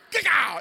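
high, shouted crash imitation; very loud mimicked collision; explosive impact noise. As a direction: burst out with a bang noise.

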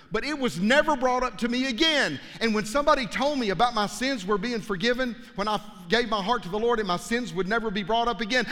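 usual speaking voice

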